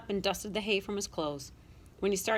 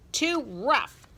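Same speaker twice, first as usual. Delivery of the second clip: high, sharp scolding cry; scolding shout; 6 semitones higher, 4 dB louder than usual. A narrator's reading. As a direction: call it out to stop the pup. Brother scolding pup.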